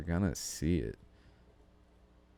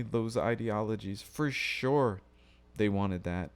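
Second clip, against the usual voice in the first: sarcastic; 6 semitones higher than usual. Acted. Sarcastic take on the election.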